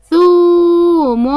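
long held oo in ثوم; ثوم with a sustained thoo; elongated letter. Shown as a demonstration. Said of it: "الحرف الممدود".

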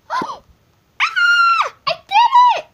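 squealing very high and loud; thrilled scream. Real, not acted. Kid gamer squealing after killing the sheep. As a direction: shriek with pure victory.

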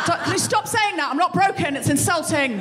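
high, exasperated outburst; irritated wife. Retold or imitated. Wife yelling at singing husband, high and fed up. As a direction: snap back, fed up with the song. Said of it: "You'd be like".

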